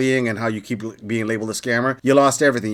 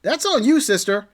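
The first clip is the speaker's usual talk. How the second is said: pointed callout; 8 semitones higher than usual. Real, not acted. Calling her out, sarcastic.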